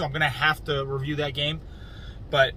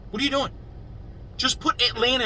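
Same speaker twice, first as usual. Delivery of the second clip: exasperated; 7 semitones higher than usual. Real, not acted. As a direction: pitch jumps way up in disbelief. Ranting at Atlanta about the name.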